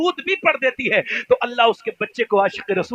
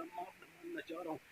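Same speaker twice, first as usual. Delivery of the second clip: put-on tearful boy; 26 dB quieter than usual. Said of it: "रो के बोला".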